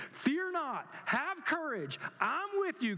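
high, ringing preacher's proclamation; preaching at full force. Real, not acted. Pastor proclaiming God's promise to the church, high and ringing. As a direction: proclaim it to the congregation, high and strong.

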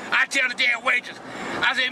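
usual speaking voice

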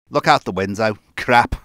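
loud sudden alarm. Acted. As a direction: shout it in shock.

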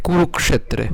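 কুরুক্ষেত্রে with ক্ষ sounded as k plus sh; Sanskrit pronunciation. Shown as a demonstration. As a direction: say কুরুক্ষেত্রে as kuruk-shetre. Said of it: "তাহলে উচ্চারণ কেমন হবে?".

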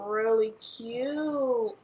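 very slowly admiring; drawn out, pleased with self. Real, not acted. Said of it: "I look really cute".

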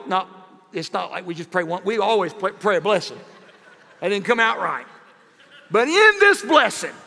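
usual speaking voice

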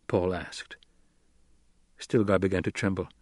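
usual speaking voice

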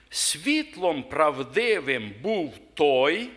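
slow, measured reading of holy text; scripture reading. Acted. Preacher reading the Gospel to the congregation, slow and solemn. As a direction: read it out solemnly, word by word. Reads Gospel of John aloud.

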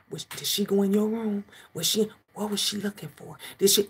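put-on hushed kids gossiping. Kids gossiping quietly about their nosy mom. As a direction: mimic the kids muttering behind her back.

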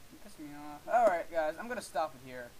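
ordinary talk, nothing marked

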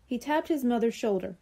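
ordinary delivery, nothing marked

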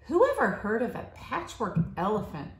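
plain reading voice

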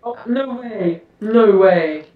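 normal talking voice

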